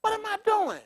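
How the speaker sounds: a caged animal asking itself in disbelief; high mock despair of a zoo animal. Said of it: "looking at the animals saying".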